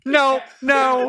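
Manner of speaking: high, loud protest through laughter; loud laughing refusal. Real, not acted. Pitcher shuts down an audience bit, loudly protesting. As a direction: shout it down while the room laughs.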